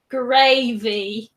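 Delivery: "Gravy" laughing, broad southern English vowel, loud; southern English accent. Shown as a demonstration. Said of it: "gravy in a southern accent".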